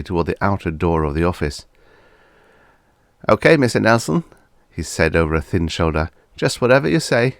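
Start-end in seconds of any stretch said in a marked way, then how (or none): 3.3-4.2 high, eager, obliging voice; a simple, cheerful yes-man; eager meek clerk. A narrator's reading. 6.3-7.4 high, eager, obliging voice; a simple, cheerful yes-man; eager meek clerk. A narrator's reading.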